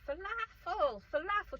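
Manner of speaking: high, loud garbled mom voice; mom's mock mumble. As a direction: put on an off-screen mom, very high-pitched. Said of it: "the mom's sort of mumbling".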